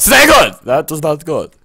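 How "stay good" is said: high loud urgent plea; shouted urging; pleading shout. Real, not acted. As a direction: yell at it to hold still. Pleading with the cupcake.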